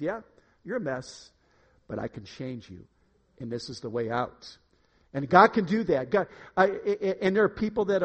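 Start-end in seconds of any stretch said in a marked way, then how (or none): 0.0-4.6 low, soft, measured reassurance; God's reassuring voice. Acted. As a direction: answer gently as God, dropping low and quiet. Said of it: "You hear this, this voice. God says".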